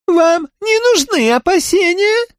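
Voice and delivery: very high monotone alien voice; high, flat and mechanical, like a crab creature. Acted. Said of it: "заявил Краб".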